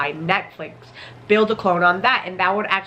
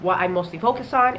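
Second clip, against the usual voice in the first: ordinary talk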